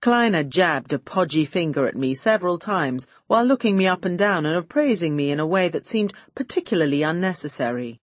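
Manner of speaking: plain reading voice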